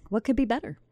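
ordinary delivery

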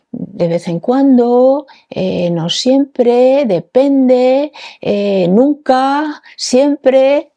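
high, drawn-out list of options; mock test answers. Host voicing possible test answers, high sing-song listing. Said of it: "había varias respuestas".

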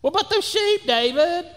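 high, mocking big-brother voice; older brother sneering at David, high and loud; belittling older brother. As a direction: talk down to the little shepherd boy, pitched high.

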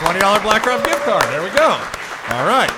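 loud, high prize announcement; celebratory announcement. Real, not acted. Host announces the gift card prize, loud and celebratory. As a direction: cheer the winner loudly. Celebrating a five-for-five quiz win.

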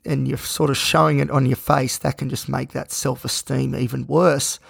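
usual speaking voice

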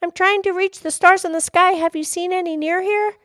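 put-on high-pitched little-girl question. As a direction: ask keenly in a high child's voice. Little girl voiced in story.

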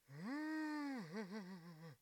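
high whining laugh; long squeal breaking into quick giggles. Real, not acted. Podcaster amused by own remark, high giggle.

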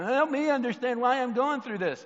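mock self-pitying crybaby plea; mock crying. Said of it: "selfish Justin's over here crying".

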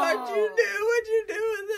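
shrill-pitched dramatic wail; high wailing cry. Pretend. Mock horror at eaten story.

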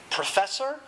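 anxious. A narrator's reading. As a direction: lift it into a worried question. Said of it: "he said anxiously".

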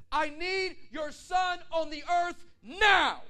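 huge slow booming shout; God's yell. Retold or imitated. Preacher voicing God yelling at him. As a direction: boom it out like a voice from heaven. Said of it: "I heard the Lord yell at me".

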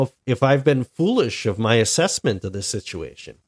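ordinary talk, nothing marked

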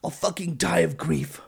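dramatic. Retold or imitated. Old father Jacob guilt-trips his sons, slow and hammed-up. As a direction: ham up the guilt trip. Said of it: "he continued".

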